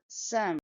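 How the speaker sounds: some with a short neutral schwa; schwa sound. Shown as a demonstration. Teacher showing the weak schwa in some. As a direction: reduce the vowel in some to a schwa. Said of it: "there is a sh sound in the second syllable".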